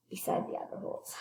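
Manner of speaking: hushed whisper. Acted. Reader hushes the children while the men wait, whispering. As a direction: whisper so nothing hears you.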